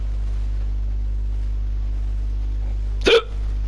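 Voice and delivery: a real, drawn-out rough cough; long coughing fit. Real, not acted. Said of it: "Apologies about that one".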